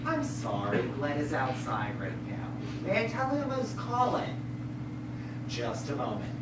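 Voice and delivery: hushed, low telephone manner; subdued receptionist politeness; polite phone answering. Acted. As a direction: make a soft, courteous excuse. Speaking to the caller.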